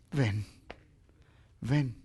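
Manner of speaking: low, soft, unhurried call; gentle invitation. Acted. Jesus answers Peter's challenge, gentle low invitation. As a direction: invite softly, pausing after the word.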